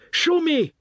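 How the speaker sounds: insist, shouting in fury; angry cry. Acted. Father insisting his daughter show him, enraged cry. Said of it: "he cried".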